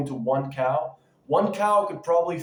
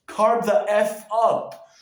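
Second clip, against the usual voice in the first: louder, higher, slow punchy hype shout; hyped shout; 6 semitones higher, 6 dB louder, slower than usual. Acted. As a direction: slam each word like a fitness ad. Hyping carbs to viewers.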